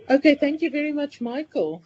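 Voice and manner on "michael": ordinary talk, nothing marked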